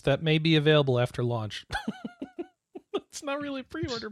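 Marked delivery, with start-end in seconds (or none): 1.7-2.4 squeaky burst of laughter; high laugh. Real, not acted. Laughing at the fake pre-order bonus.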